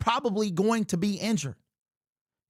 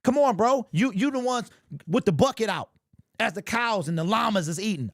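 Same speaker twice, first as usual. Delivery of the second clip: dopey sightseeing fan. Retold or imitated. Mocking content fans as gushing kids on a road trip. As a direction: play a dazzled tourist at the window. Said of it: "Y'all just looking out the window".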